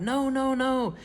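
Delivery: high loud repeated no; desperate no. A narrator's reading. Family crying out as Grandma drinks, high desperate. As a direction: plead with her to stop. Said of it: "the other two cried".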